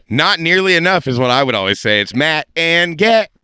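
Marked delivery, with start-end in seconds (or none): 2.0-3.3 show intro. Acted. Show name announced as catchphrase.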